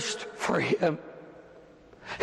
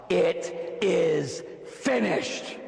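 very slow declaration; much slower than usual. Acted. Jesus to Satan, very slow declaration. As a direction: draw out "It is finished" at a slow crawl. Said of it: "he says".

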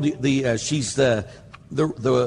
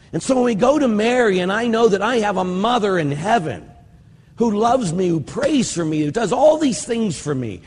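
fervent preaching; 7 semitones higher, 3 dB louder than usual. Real, not acted. Preacher building to fervent hope.

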